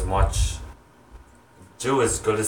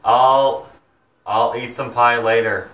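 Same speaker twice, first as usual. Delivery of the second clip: louder call to someone in another room; calling out to mom; 3 semitones higher, 7 dB louder than usual. Real, not acted. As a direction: shout the answer back to mom offstage.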